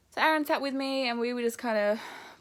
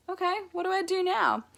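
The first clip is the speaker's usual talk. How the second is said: higher, light bemused question; bemused higher-pitched question; mock puzzled; 10 semitones higher, 5 dB louder than usual. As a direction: play the idle new mum. Lying relaxed after birth.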